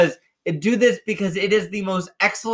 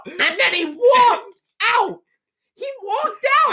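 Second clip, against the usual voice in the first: crying out with laughter, much higher; laughing high cry; 12 semitones higher than usual. Real, not acted. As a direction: squeal it out through laughter. Laughing disbelief retelling.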